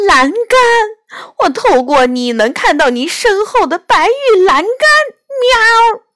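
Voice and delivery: high-pitched, alarmed cat outcry; excited high cry ending in a mew; sudden cry. A narrator's reading. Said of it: "她突然大叫道".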